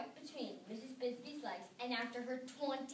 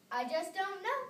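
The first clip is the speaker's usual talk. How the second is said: tiny voice; 7 semitones higher, 4 dB louder than usual. A narrator's reading. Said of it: "in a tiny voice".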